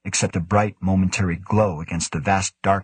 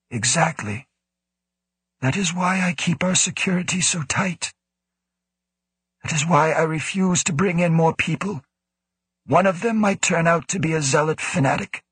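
put-on raised, firm old director voice; guarded director; 9 semitones higher than usual. A narrator's reading. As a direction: insist in a higher, firm voice. Woe justifies his secrecy.

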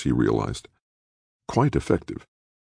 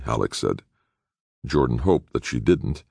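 ordinary delivery

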